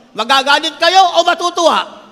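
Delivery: ordinary talk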